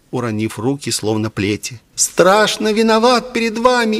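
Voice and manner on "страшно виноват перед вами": put-on high, agitated boy confessing; tearful confession. A narrator's reading. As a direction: plead guilty in an anguished falsetto. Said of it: "слезы покатились по его впалым щекам".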